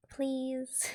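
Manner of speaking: high, small pleading voice; acted high-pitched plea. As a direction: beg in a high put-on voice.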